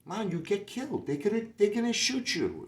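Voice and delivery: alarmed Jamaican guy pleading with him; high, worried Jamaican accent, sounding the alarm. Retold or imitated. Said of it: "He go".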